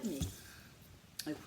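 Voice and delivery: ordinary delivery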